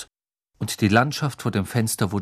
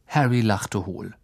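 plain reading voice

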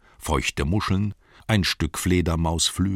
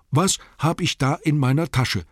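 said aloud; 9 semitones higher, 4 dB louder than usual. A narrator's reading. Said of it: "sagte er laut".

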